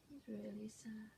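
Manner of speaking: soft whispered, hushed sadness. Acted. Teacher tells the class about the sad bunny, whispering.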